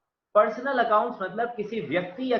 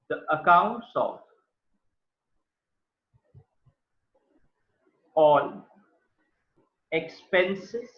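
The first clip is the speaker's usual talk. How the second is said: spaced out, word by word as if writing; slow dictation; much slower than usual. Shown as a demonstration. Teacher dictating to students, slow and spaced. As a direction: dictate slowly while writing on the board. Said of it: "writing on board, dictating".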